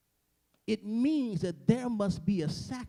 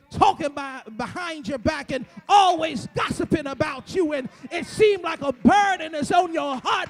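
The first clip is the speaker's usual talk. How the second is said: higher and louder, shouting the sermon up to a peak; preaching at full force; 6 semitones higher, 6 dB louder than usual. Acted. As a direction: build it like a Sunday whoop, climbing each phrase. Call-and-response preaching refrain.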